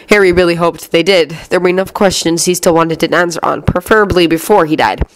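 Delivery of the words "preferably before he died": plain reading voice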